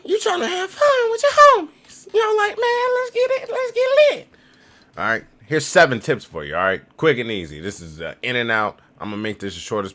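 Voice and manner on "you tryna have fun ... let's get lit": high, loud, put-on hype voice; cartoonish party-bro voice, way up high; hyped party bro. Mocking a tourist hyping up his homies, in a high hyped voice.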